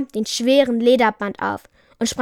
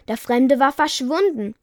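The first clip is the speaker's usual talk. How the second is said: ordinary delivery, nothing marked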